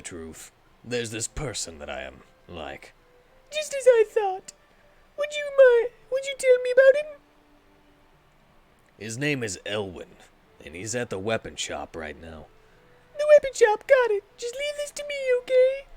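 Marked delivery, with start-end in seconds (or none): 3.5-7.2 put-on high, gentle coaxing girl; high sweet helper. Same voice. 13.2-15.9 put-on high, gentle coaxing girl; high sweet helper. Same voice.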